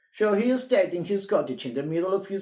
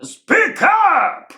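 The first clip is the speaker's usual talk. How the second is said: high, loud shout; yelling; 11 dB louder than usual. Acted. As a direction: yell it across the counter. Grocer yells at customer.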